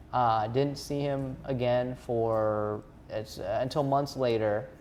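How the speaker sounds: ordinary talk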